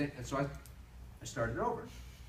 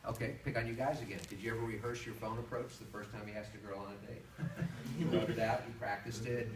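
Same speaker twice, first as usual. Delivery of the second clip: normal talking voice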